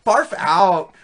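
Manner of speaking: put-on loud, slow Valley Girl whine. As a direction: play the disgusted Valley Girl. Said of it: "Valley Girl talk".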